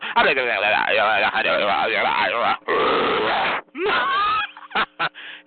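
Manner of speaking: wordless babbling outburst. Real, not acted. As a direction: babble wildly, then break into laughter. Wordless outburst while goading silent caller.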